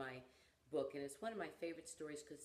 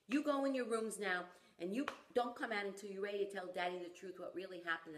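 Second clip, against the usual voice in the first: higher, louder stern dad command; yelled order; 4 semitones higher, 6 dB louder than usual. Retold or imitated. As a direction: send the kids to their rooms sharply. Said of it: "And he yells at them".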